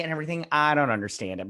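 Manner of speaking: ordinary talk, nothing marked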